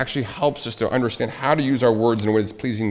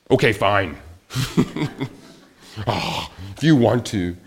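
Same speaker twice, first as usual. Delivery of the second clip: grudging agreement; 4 semitones lower than usual. Retold or imitated. Grudging reply acted in argument.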